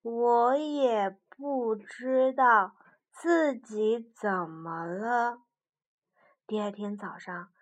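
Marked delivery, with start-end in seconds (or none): 0.0-5.4 slow, puzzled bear; slow and lost. Acted. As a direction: say it slowly, unsure.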